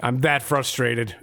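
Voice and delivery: ordinary delivery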